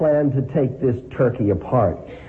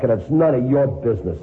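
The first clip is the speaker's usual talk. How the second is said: plain reading voice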